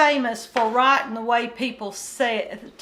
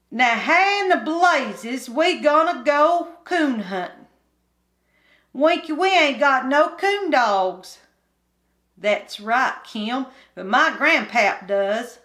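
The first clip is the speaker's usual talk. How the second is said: put-on higher, louder hillbilly boy complaining; bored country boy; 3 semitones higher, 4 dB louder than usual. Kim complaining to Winky about boredom, higher country boy voice. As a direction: whine it like a restless mountain kid. Boys argue.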